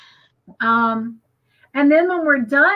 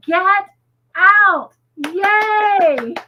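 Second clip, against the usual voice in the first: high, loud, disbelieving cheer; excited; 8 semitones higher, 4 dB louder, much slower than usual. Real, not acted. Host cheering a viewer's milestone, very excited. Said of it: "getting so excited".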